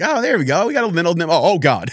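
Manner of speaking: high-pitched mock thrill at a bite; lightly hammed-up anticipation; acted fisherman's excitement. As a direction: play at getting hooked, starting high and easing down. Acting a fisherman feeling a bite.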